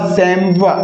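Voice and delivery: सैमवा as "saim-wa", silent final s; correct pronunciation. Shown as a demonstration. Teacher revealing the answer to students, सैमवा as "saim-wa". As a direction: say सैमवा in two syllables ending "wa". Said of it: "correct जो pronunciation है".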